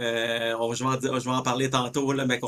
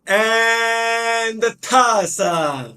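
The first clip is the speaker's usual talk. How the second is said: high, drawn-out announcer call; imitating Louis; 8 semitones higher, 5 dB louder, much slower than usual. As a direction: stretch it out like a hype man. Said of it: "comme dirait mon ami Louis".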